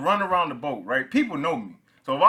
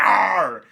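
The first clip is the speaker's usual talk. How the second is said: loud mock dinosaur roar, pitched up; T-Rex roar; 6 semitones higher, 9 dB louder than usual. Sailor imitating the T-Rex shipmate's roar in the galley. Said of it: "He'd be like".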